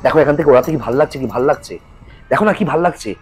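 coaxing, high and fast; luring voice. Acted. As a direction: tempt him toward the edge. Palash lures Tutu to edge.

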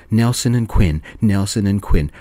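ordinary delivery, nothing marked

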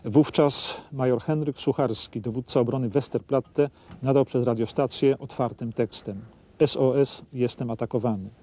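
low, grave history narration; documentary narration. A narrator's reading. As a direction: read it like a solemn wartime chronicle. Guide reads documentary narration.

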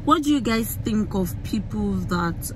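ordinary talk, nothing marked

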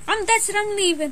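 usual speaking voice

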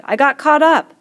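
high, loud and flustered; calling out stuck. A narrator's reading. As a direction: shout it while tangled in the creepers. Boy calling out stuck in creepers.

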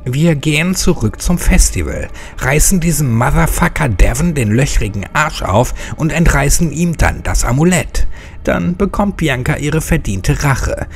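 rally the others to fight; fired up. Acted. Hexe pitches her revenge plan to her friends, eager and combative.

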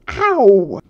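very loud "ow" of pain; sudden loud cry at being hurt; pained yelp. A narrator's reading. Tucker kicked by Sam, pained yelp.

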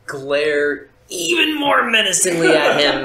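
laughing. Real, not acted.

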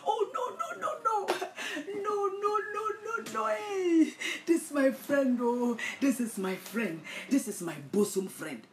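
high, hammed-up fake crying; false friend fake-wailing at the funeral; mock wailing. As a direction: overact a mourner's wail. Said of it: "you think that they are crying".

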